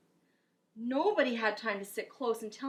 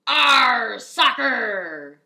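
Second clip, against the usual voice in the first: loud, slow, drawn-out pirate crew cry; howling for the lost ball; crew yelling; 2 semitones higher, 15 dB louder, much slower than usual. Acted. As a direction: bellow it like a whole crew. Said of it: "the crew yelled".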